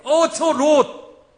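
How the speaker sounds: high, loud and very slow; drawn-out exclamation. Acted. Preacher calling out Lot's name to the congregation. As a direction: stretch out each word in a loud, high cry.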